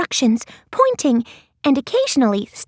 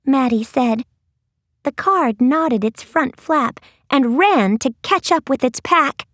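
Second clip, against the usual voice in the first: ordinary delivery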